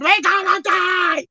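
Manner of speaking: high, slow mock scream of terror; mock panicked scream. Preacher acting scared disciples, mock panicked scream. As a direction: wail it like a man sure he'll drown. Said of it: "We're screaming to the top of our lungs".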